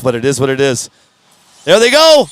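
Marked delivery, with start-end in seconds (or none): 1.6-2.3 excited. Real, not acted. Announcing race start.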